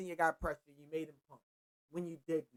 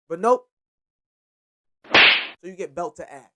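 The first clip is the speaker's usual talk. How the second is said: much louder, slow and emphatic; real emphatic call, drawn out; loud verdict; 13 dB louder, slower than usual. Real, not acted. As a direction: slam the punchline down. Punchline verdict on the quarterback.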